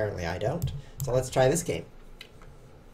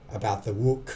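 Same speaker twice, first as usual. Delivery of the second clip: mock French wook; 3 semitones lower than usual. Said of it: "It's just fun to say".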